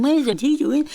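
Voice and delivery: light mock yell; retold class screaming. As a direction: mildly imitate a roomful of women screaming. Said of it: "all screaming".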